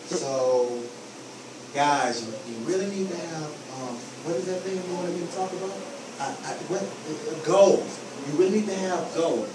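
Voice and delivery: low, soft, dragging and lost; depressed. Shown as a demonstration. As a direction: mumble like your dog just died. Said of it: "if I come in here like this".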